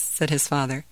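plain reading voice

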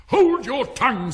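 high, loud, furious command; enraged. A narrator's reading. Queen turning purple at Alice, enraged shout. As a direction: silence them in a rage. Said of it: "turning purple".

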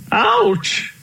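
high-pitched "ouch" of pain; pained cry. A narrator's reading. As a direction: cry out as if something aches. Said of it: "cried Uncle Elephant".

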